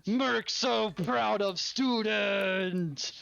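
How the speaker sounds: slow drawn-out brute voice, full of pride; Merc's proud voice. Acted. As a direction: hug the student and drag each word. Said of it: "as she grabs you and goes".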